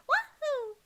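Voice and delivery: very high "Wahoo"; Mario impression. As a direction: squeak it like Mario. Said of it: "Proto Mario be like".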